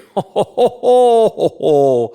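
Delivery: loud, high "ho ho ho" laugh; Santa belly laugh. Acted. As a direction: roll out a big, drawn-out Santa chuckle. Santa laughs after the story.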